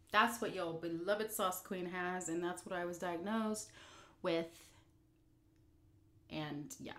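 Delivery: ordinary delivery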